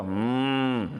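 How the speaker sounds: imitated cow moo. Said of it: "It sounds like a cow".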